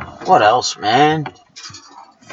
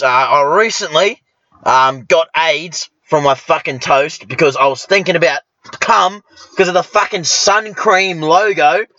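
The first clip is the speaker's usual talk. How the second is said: usual speaking voice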